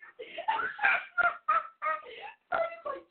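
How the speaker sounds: high shrieking laugh. Real, not acted. Losing it over the joke.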